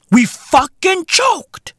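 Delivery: slow, high, emphatic admission; high and slow, hammering it home; drawn-out exasperation. Real, not acted. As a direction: stretch each word out in disbelief.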